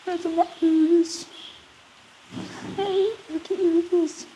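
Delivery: very high soft calling voice; calling a cat. Real, not acted. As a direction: coo softly to call the cat. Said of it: "me calling for the cat".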